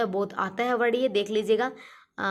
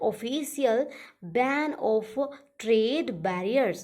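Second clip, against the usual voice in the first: dictating slowly; much slower than usual. Shown as a demonstration. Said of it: "meaning repeated slowly".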